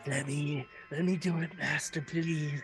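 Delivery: the carpenter begging his master to let him do it; eager pleading servant. Acted. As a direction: grovel for permission, hungry for it.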